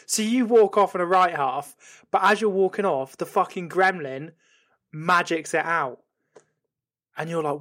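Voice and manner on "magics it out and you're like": usual speaking voice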